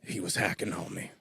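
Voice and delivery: low, soft mock outlaw reply; Billy the Kid. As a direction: answer quietly in a put-on gunslinger voice. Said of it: "Billy the Kid".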